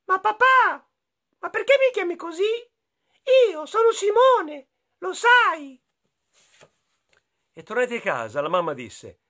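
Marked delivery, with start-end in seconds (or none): 0.1-5.8 put-on high childlike protesting voice; surprised little boy. Little Simone correcting his dad, high and loud. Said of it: "esclamò sorpreso".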